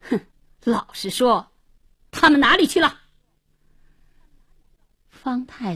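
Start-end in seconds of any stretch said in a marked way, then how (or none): 0.1-3.0 huffed demand, rising to a shout; angry, bullying questioning; threatening interrogation. A narrator's reading. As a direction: demand an answer, exploding on the question. Gunman interrogates the landlords.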